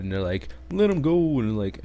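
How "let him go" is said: squeaky high let him go; mock high voice. Mocking the duelists demanding in a high voice. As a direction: pitch it up to mock them.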